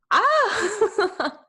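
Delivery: sudden high emotional squeal; overwhelmed high cry. Real, not acted. Overwhelmed by a quote she loves, high squeal. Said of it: "I need to calm down".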